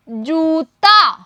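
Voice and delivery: जूता said very slowly; slow model word. Shown as a demonstration. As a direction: lengthen every sound of जूता. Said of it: "मेरे साथ साथ बोलने का अभ्यास". (जूता धीरे-धीरे बोलें)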